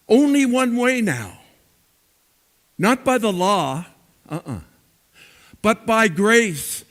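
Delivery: impassioned sermon delivery with swelling emphasis; preaching. Acted. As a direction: preach it to the congregation, rising on key words. Pastor preaching to congregation.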